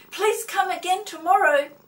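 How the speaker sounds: put-on high, very loud children's shout. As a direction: shout it like kids at the bus stop. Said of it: "they shouted".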